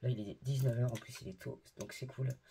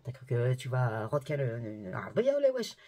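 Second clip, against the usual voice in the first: énervée; 3 semitones lower, 4 dB louder than usual. Real, not acted. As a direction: burst out in indignant disbelief. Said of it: "je te jure, je suis énervée". (énervée, exclamations indignées)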